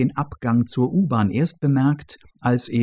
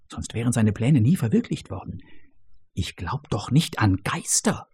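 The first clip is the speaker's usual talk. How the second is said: trying to shout but no sound comes; a scared man's strangled hush; voiceless hushed protest; 4 dB quieter than usual. Acted. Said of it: "will er rufen, doch das Stimmband lässt keinen Laut".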